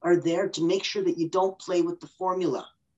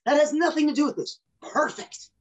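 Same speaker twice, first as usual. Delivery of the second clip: mock protest; 8 semitones higher than usual. Acted client protest.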